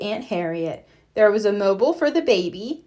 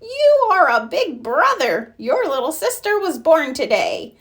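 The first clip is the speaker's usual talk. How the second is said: bright, higher and louder announcement; excited news; 6 semitones higher, 4 dB louder than usual. A narrator's reading. Granny telling Franklin his sister is born, delighted. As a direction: burst out the good news.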